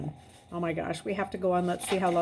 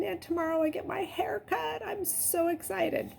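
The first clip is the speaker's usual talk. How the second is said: excited whisper; 11 semitones higher than usual. Real, not acted. Teacher shares excitement about haircut.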